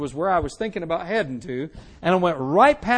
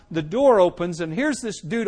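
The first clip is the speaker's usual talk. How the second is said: ordinary talk, nothing marked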